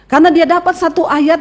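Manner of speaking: normal talking voice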